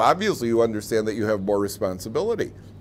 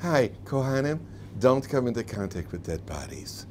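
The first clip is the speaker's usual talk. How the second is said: gentle, softer mock instruction; a hint of soft-spoken God; speaking softly; 6 dB quieter than usual. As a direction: say it kindly, like a gentle request. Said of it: "speak softly to the Kohanim".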